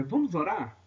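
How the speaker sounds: normal talking voice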